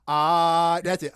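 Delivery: usual speaking voice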